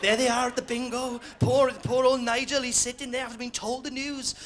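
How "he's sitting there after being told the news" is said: ordinary talk, nothing marked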